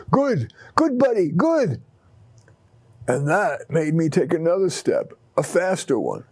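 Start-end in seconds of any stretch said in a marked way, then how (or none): 0.1-1.8 higher, bright delighted praise; exclaiming with praise. Acted. Owner praises his old dog, excited and higher. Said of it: "Bill exclaimed".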